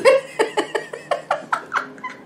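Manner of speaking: breathy high-pitched laughter. Real, not acted. A vlogger laughing at her husband's reply. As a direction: laugh it off, airy.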